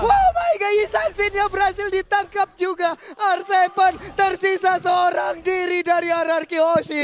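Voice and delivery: very high shriek, then loud calling; excited shout. Real, not acted. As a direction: scream in disbelief. Co-caster shrieks at the play.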